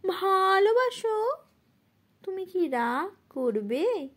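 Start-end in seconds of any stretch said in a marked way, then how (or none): 0.1-1.3 high, anxious plea in a recitation; frightened voice. Acted. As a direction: ask it fearfully, pitched up. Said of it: "ভয়ের তো কণ্ঠে". (ভয়ার্ত কণ্ঠে আবৃত্তি)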